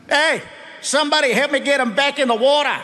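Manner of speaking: high, far-off Aussie heckling voice; mocking beachgoers. Beachgoers jeering at the big man, laughing and high.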